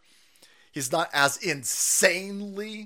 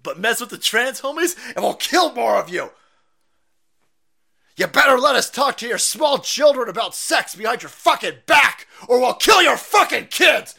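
loud, higher ranting yell; mock furious shout; 8 semitones higher, 11 dB louder than usual. Mocking the activists' threat, shouting loudly. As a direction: scream the caricatured threat.

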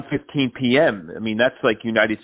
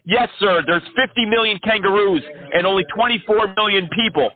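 high, excited ranting crank; manic caller; 8 semitones higher than usual. Acted. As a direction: rant like an unhinged crank caller. Crank caller rants at scammer.

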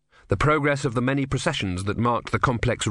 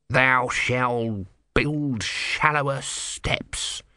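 slow, lightly hissed god's curse; hissing; 2 semitones higher, much slower than usual. Acted. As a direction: hiss the commandment through effort. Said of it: "he hissed".

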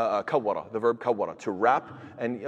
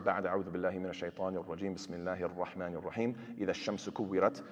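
quick, softer recited Arabic formula; formal recitation opening; 4 semitones lower, 8 dB quieter, much faster than usual. Shown as a demonstration. Teacher opening the lesson verse, recited quickly. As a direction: recite the opening in a set chant-like voice. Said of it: "we start".

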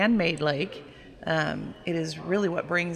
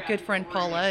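normal talking voice

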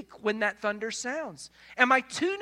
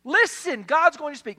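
raised, urgent call to listen; urgent proclamation; 5 semitones higher, 7 dB louder than usual. Acted. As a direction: announce it like a herald before the storm. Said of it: "Elihu says".

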